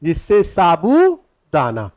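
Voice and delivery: very slow, stretched dictation. Shown as a demonstration. Teacher dictating sago's source to the class, slow dictation. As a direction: draw the words out for note-takers. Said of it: "अब पहला point लिखिएगा".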